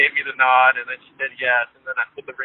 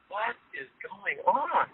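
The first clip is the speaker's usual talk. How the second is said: puzzled friend; 7 semitones higher, 4 dB quieter than usual. Retold or imitated. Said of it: "like, puzzled".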